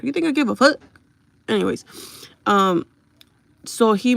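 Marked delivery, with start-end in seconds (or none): 0.0-0.8 defiant retort. Real, not acted. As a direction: throw it back at the hater.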